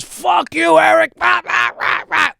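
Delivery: high, loud mock shout at the umpire; a hammed-up player blowing up at the ump; angry yell at the ump. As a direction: ballplayer screaming at the umpire over a called strike. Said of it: "Dykstra turns around and goes".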